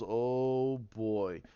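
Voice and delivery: dreading what comes through the door; Rey bracing as the door starts to give. Retold or imitated.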